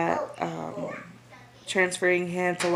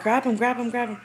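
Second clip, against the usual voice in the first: urgent plea; 5 semitones higher than usual. Retold or imitated. Baby sliding off the gurney.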